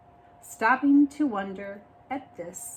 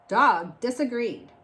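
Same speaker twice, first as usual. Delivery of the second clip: plain reading voice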